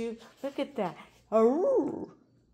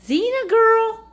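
much higher, loud sing-song call; high, loud dog-calling voice; 11 semitones higher, 9 dB louder, slower than usual. Real, not acted. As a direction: call her over from across the yard.

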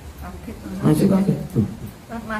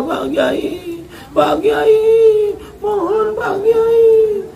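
sobbing plea; slower than usual. Acted. Daughter-in-law begs the Kiai for the antidote, sobbing and high. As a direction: beg through sobs, voice high and breaking. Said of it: "ngerumah Pak Kiai nangis-nangis".

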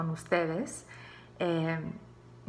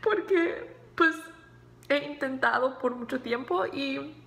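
teary; 6 semitones higher than usual. Real, not acted. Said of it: "I'm crying".

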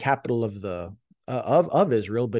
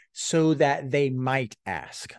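slow, deliberate gloss; much slower than usual. Shown as a demonstration. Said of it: "That's the purpose".

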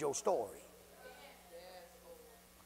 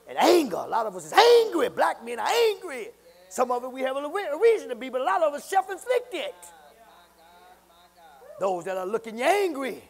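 loud, high shouted preaching; rising from testimony to a full shouted praise break; full-force preaching; 11 semitones higher, 16 dB louder than usual. Acted.

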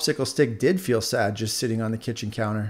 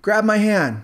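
higher, louder command; urgent call; 10 semitones higher, 6 dB louder, slower than usual. Acted. Popsicle Stick calls down to the trapped earbud, urgent. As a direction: reach down and call out firmly.

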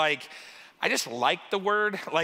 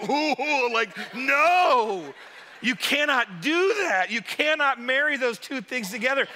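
higher-pitched, emphatic refusal; mock outrage; 6 semitones higher, 4 dB louder than usual. Italian pastor forbidding pineapple on pizza, mock outrage. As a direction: forbid it with hammed-up horror.

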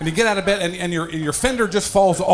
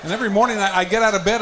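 usual speaking voice

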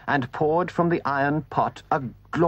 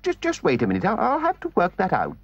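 flustered Toad; 9 semitones higher, faster than usual. A narrator's reading.

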